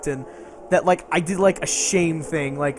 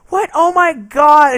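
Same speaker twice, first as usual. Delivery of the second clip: higher, louder, slow shocked cry; shocked gasp; 8 semitones higher, 8 dB louder, slower than usual. Retold or imitated. The player reacting to Cthulhu arriving, loud shocked slow cry. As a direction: drag it out in horror. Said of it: "She's like".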